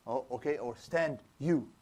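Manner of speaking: usual speaking voice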